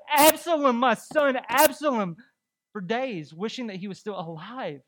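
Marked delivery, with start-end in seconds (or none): 0.1-2.2 loud, higher cry of a grieving father; imitated weeping cry. Preacher voicing David mourning his son, loud imitated cry. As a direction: call out for a lost son, lightly acted. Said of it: "He cried, he wept".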